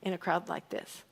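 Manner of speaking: doubtful question, dropping away softly; dismissive reading. Shown as a demonstration. Preacher repeats how he used to read Andrew's line, dismissive. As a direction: let the doubtful question fall off at the end. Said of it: "how I've read that in the past".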